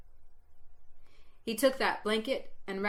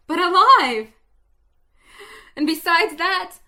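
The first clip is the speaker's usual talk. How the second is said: higher and much louder, bursting with wonder; exultant; 4 semitones higher, 8 dB louder than usual. A narrator's reading. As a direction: cry out the miracle in joy. Resurrection revealed, exultant.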